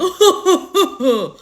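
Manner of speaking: high, repeated fake "ho-ho-ho"; hammed-up comic laugh; mock dramatic sound effect. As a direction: imitate a cheesy transition sound with laughs. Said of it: "I'm gonna use dramatic transition sounds".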